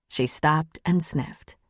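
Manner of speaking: plain reading voice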